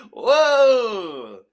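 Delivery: high squealing laugh into a loud whoa; laughing surprise. Real, not acted. Teacher reacting to her own sweating with a high laugh. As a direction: burst out laughing, then exclaim.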